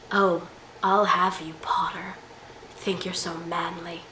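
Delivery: whispered flirty threat; Draco Malfoy. Acted. Vlogger mocks Draco's tension with Harry, flirty whisper. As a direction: whisper it as a mock-seductive taunt.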